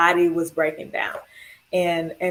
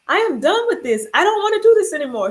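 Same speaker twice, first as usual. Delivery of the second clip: retold protest; 10 semitones higher, faster than usual. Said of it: "I think I'm done".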